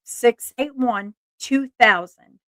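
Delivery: slowly, digit by digit; slow and deliberate. Real, not acted. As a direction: spell the number out slowly for viewers. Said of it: "this is the number".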